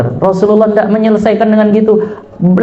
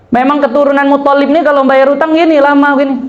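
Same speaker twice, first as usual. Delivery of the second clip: mock rude creditor; 8 semitones higher than usual. Creditor insulting Nabi's family.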